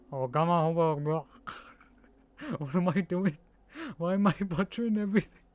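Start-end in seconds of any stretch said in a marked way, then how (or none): 1.3-5.6 laughing through the words; helpless laugh at your own blunder; real laughter. Real, not acted. Streamer mocking own butchered pronunciation, laughing.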